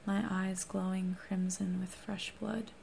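plain reading voice

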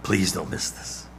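preacher urging the congregation, nearly whispered; urgent hush. Acted. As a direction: lean in and plead quietly. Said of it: "hear me now".

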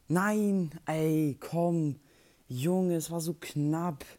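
loud, high, frustrated yell after losing; frustrated shout. Real, not acted. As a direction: shout it out, gutted and angry. Just lost the round.

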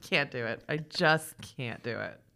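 low, soft, slow resignation; defeated. Pretend. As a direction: sigh it out in defeat. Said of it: "And I'm like".

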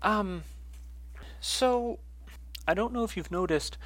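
high, halting woman's character voice; Martine. Acted. Martine joins Essie for a stroll, high halting voice. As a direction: speak up high, feeling your way in. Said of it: "my voice is gonna be all over the place for her".

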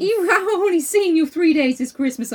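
high, loud exasperated scolding; hammed-up disbelief at a visiting uncle; mock outrage. Acting Scout scolding Uncle Jack.

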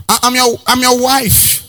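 high, slow mock wife stammering it out; nagging wife, stammering. Pastor mimicking a wife stumbling over her claim, slow. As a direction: stutter the line in a mocking wife's voice.

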